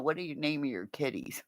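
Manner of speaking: ordinary talk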